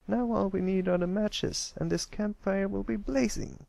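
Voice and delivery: high, eager little colt; a small boy sure the fire is close; Pipsqueak, high kid voice. Acted. Pipsqueak telling friends the fire is nearly ready, high eager colt voice.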